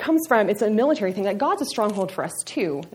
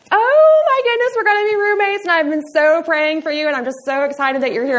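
put-on gushing Texas girl; 8 semitones higher, 8 dB louder than usual. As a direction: gush like a sugary Texas sorority girl. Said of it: "I sounded like I was from Texas".

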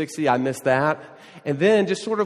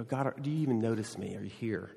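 quiet plea; 4 semitones lower, 8 dB quieter, faster than usual. Retold or imitated. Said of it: "Or, you know".

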